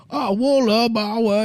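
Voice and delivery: high, loud mocking nonsense syllables; drunkenly mock a language; mock gibberish. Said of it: "making fun of".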